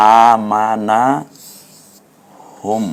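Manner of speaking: আ, মা, না each held long, হুম hummed shut; one-alif stretch. Shown as a demonstration. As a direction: draw out আ, মা, না evenly. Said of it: "এক আলিফ টান দেব".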